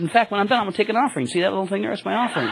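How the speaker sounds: high, playful mock offering pitch, laughing at the end; joking. Driver jokingly asks his passenger for an offering, high and laughing. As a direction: tease him about the offering with a grin. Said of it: "I was, I was joking".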